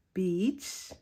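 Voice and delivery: "beach" with a long, stretched vowel; elongated word. Shown as a demonstration. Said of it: "elongating certain words like".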